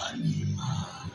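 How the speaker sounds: low, slow animal growl; growled dragon word; beast voice. Shown as a demonstration. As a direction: sound like a monster. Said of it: "Hasta que suena como un".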